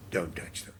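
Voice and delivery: whispered final warning, low and hard; hushed warning. Retold or imitated. Director quietly warns casting lady off his actors, whispered. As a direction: drop to a whisper to end the rebuke.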